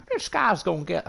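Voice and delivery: high-pitched attempt at a London football manager's accent; badly faked London manager accent, pitched right up; Harry Redknapp accent. Streamer mimicking a manager hyping a new signing, badly faked London accent. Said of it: "I can't do a Harry Redknapp accent".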